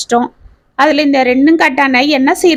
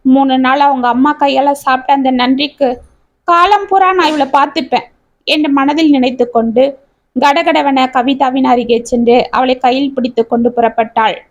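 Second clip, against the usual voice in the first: ordinary delivery, nothing marked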